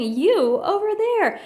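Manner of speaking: high, loud slow call. A narrator's reading. Horton calling to the bug by the mound, high and loud. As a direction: call across the distance. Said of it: "called the elephant".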